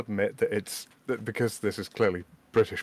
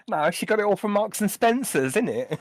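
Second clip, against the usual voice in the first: put-on higher, louder Essex accent; 10 semitones higher, 5 dB louder than usual. Mimics Essex influencer again.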